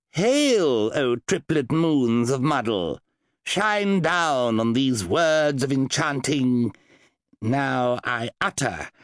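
slow, grand spell recitation; solemn incantation. Acted. Wizard reciting a summoning spell, slow and grand. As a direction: intone it like an ancient rite. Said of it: "began to recite the incantation".

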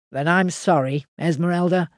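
a countryman ashamed of what he's done; lightly voiced farmer, guilty; Henry the farmer. A narrator's reading. Henry apologizes.

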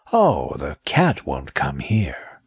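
slow, low whisper. Acted. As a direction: soothe her in a hush. Snake reassures softly.

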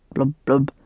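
low imitated blub-blub of water; short low burble mid-sentence; soggy washing noise. A narrator's reading. Earl's speech interrupted as he washes, soggy blubbing. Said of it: "interrupted by soggy noises".

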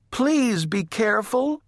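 high, pleading little voice; worried little sister. A narrator's reading. Baby warns her sister during the chase, high and pleading. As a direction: urge caution, pitched high.

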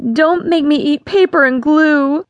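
put-on a girl begging her father; wailing plea. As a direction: beg him not to. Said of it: "she wailed".

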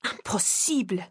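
French-accented protest, lightly voiced; a hint of a French accent, first insistence; strong French accent. A narrator's reading. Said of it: "repeating in his strong French accent".

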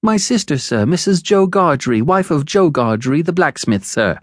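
put-on high, anxious child explaining; high-pitched timid reply; scared little boy. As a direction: answer respectfully in a nervous boy's voice. Timid boy answers convict.

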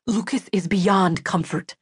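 understated angry half-whisper. A narrator's reading. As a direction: keep it close and bitterly angry. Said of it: "to whisper angrily in my face".

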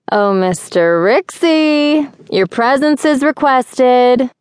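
slow, high sing-song summons. A narrator's reading. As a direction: call him out in a drawn-out, lilting singsong. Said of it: "she said in a sing-song voice".

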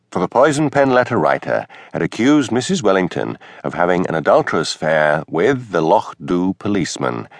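plain reading voice

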